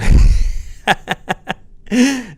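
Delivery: high loud burst of real laughter. Real, not acted. Laughing at his own fuck-that-guy joke. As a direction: crack up at your own joke.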